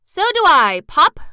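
high, loud chiming-in; eager young roll. Acted. Roll backing up Pop, eager and loud.

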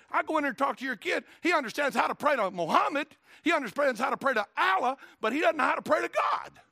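high, loud preaching shout, building; fiery preacher's mounting indignation; indignant rebuke. Acted. Acts Nehemiah rebuking fathers about children.

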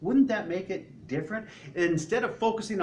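ordinary delivery

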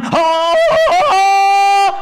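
high, loud, long Tarzan-style holler; whooping jungle call; jungle vine yell. Acted. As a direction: yodel out as you swing through trees. Imitates swinging on jungle vine.

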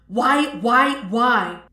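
exasperated, forceful questioning; frustrated cry. A narrator's reading. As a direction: cry out each word in frustration. Frustrated inner cry at failed bending.

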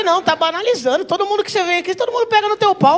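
high-pitched offended protest; mock indignation. Patient protesting nurse's handling, mock indignant. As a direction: complain high, playing the wronged party.

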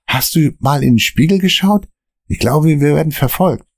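nervy friend glancing at the mirror; nervous Dan. Acted.

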